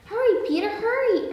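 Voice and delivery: high-pitched little sparrow voice; urgent, high storybook pleading; urging. A narrator's reading. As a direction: urge him on in a high bird voice. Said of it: "urged some friendly sparrows".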